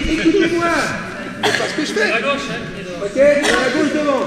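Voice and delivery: laughing through the words, high and loud; amused coach scolding through laughter. Real, not acted. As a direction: laugh while correcting the class. Coach laughing at group's mistake.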